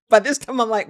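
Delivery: normal talking voice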